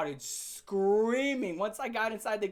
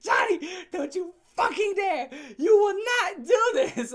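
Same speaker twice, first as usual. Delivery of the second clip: much higher, louder, slow shrill warning; shrieking order; 10 semitones higher, 7 dB louder than usual. Retold or imitated. Girlfriend shrieking at Johnny not to do it, loud. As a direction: scream it like a panicked girlfriend.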